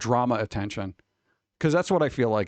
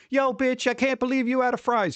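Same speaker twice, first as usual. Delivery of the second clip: mock customer; 11 semitones higher than usual. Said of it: "somebody's like".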